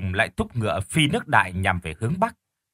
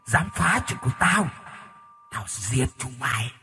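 possessed threat; 3 dB quieter than usual. Acted. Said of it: "gào lên".

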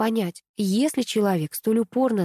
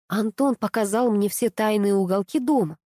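usual speaking voice

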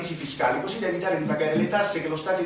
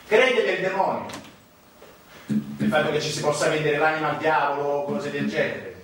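ordinary talk, nothing marked

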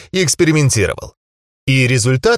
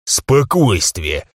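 calm, commanding; 5 semitones lower, much slower than usual. Acted. Calming the angry crowd.

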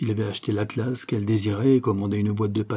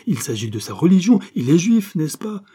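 loud and much higher, accusing; indignant insistence; 7 semitones higher, 5 dB louder than usual. A narrator's reading. Mother insisting on the suitor's religion, outraged. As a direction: jab each word in outrage.

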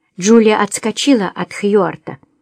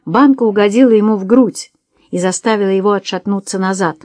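ordinary delivery

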